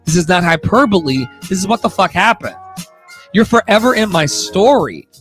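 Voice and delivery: usual speaking voice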